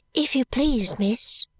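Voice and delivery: put-on a shy young maid speaking softly; soft little girl. A narrator's reading. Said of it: "said the little girl in a soft voice".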